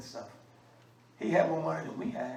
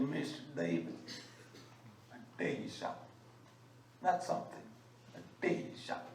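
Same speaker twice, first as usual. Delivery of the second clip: country man's voice; 3 semitones lower, 11 dB quieter, faster than usual. Retold or imitated. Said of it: "That's how he talk".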